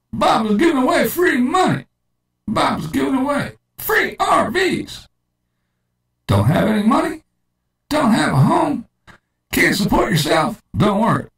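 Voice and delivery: very high, excited pitchman; high cult hype voice. Shown as a demonstration. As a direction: squeal it like a cult hype man. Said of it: "Or we could do it this way".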